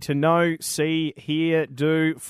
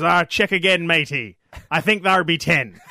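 pirate voice; 3 dB louder than usual. Acted. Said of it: "He goes".

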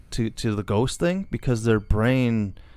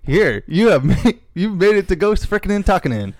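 laughing; 7 semitones higher, 6 dB louder than usual. Real, not acted. Laughing at their botched logo.

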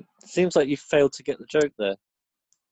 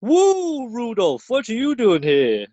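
high whooping Santa, slower; Santa as Ric Flair; 9 semitones higher, 4 dB louder, slower than usual. Retold or imitated. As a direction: Santa bellowing like a wrestler. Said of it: "Santa going".

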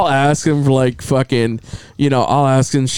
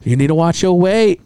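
higher, imitated Chinese accent; Chinese lady doctor; 4 semitones higher than usual. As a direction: mimic a Chinese woman doctor's warning. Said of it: "was a Chinese lady".

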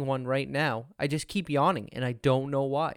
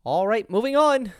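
much higher, louder catchphrase; mock host segue; 9 semitones higher, 6 dB louder than usual. Co-host parodying the old episodes' transitions, exaggerated segue. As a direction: push it up high and loud. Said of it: "That was it, every time".

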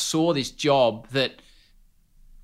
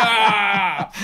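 a higher startled "Ah!"; surprised cry; 7 semitones higher than usual. Retold or imitated. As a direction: caught out, gasp it. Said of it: "I'm like".

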